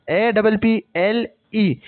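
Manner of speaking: each letter slowly and separately; slow spelled-out letters. Shown as a demonstration. Teacher spelling apple slowly for students, dictating each letter.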